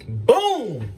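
usual speaking voice

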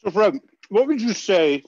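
talking through a mouthful of steak; words round a bite of food; mouth full. Real, not acted. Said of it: "pardon my full mouth here".

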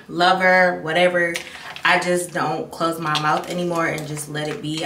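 usual speaking voice

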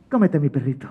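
soft drop out of the wail; sly offer. Acted. Mateo giving up his dog, soft and sly.

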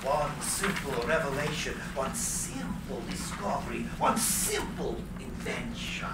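low, fading into a whisper; dreamy whisper of his vision; hushed reverie. Acted. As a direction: breathe the words as a secret dream. Tesla's inner reverie.

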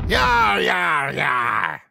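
loud pirate growl, falling each time. Acted. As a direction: bellow it like a rowdy pirate. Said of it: "The pirate says".

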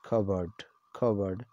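cupboard said KUB-urd, the P silent. Shown as a demonstration. Teacher models cupboard as KUB-urd for viewers. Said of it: "The same pronunciation in both the countries".

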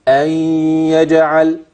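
أن يجعل chanted as ay-yaj'al, the y held nasally; merging with ghunna. Shown as a demonstration. Teacher repeats after asking if they heard, nasal merged chant. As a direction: melt the n of أن into the y of يجعل. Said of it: "هحول النون دي لياء".

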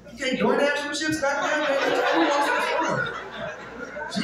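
put-on a lazy kid brushing off his dad; mocking teen kid. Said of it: "They look at you like".

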